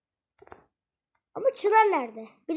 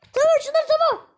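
high, loud panicked yelp; panicked high yell; 12 semitones higher, 9 dB louder than usual. Real, not acted. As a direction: yelp at Çınar to back off. Friend chasing him in game.

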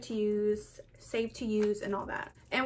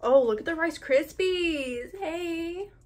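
excited cooing; 6 semitones higher, 4 dB louder, slower than usual. Real, not acted. Cooing at bunny candy.

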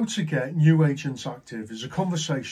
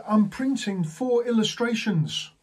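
high, slow, imitated proud announcement; very happy; 8 semitones higher, 4 dB louder than usual. Happy agent by the printer to manager, elated. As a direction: draw it out in mimicked delight. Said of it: "looking very happy, happy".

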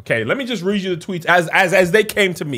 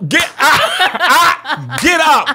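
bitten woman yelping; 10 semitones higher than usual. Acted. Host acting the woman whose thigh gets bitten, high laughing yelps. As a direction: yelp get up as if bitten mid-date.

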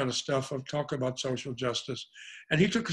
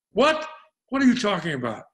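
high-pitched, louder incredulous "what?"; startled retold reply; 7 semitones higher, 6 dB louder than usual. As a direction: act out being caught completely off guard. Retelling his startled reply.

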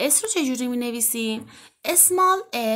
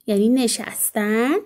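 ordinary talk

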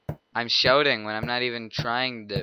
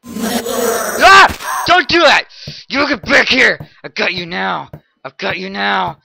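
high, loud chase yells; menacing shout; 9 semitones higher, 13 dB louder, slower than usual. Acted. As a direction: scream threats as you chase them down. Killer chasing victim, shouting.

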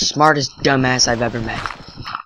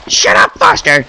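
loud, sharp yell; shouting; 3 semitones higher, 10 dB louder than usual. Real, not acted. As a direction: yell it at your friend. Yelling at a friend.

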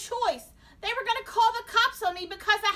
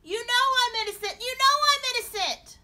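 high, frantic shouting; desperate protest; 17 dB louder than usual. A narrator's reading. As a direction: plead at the top of your voice. Nightmare defendant pleading in court.

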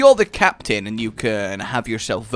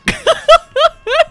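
high laugh; 9 dB louder than usual. Real, not acted. Laughing at game voices.

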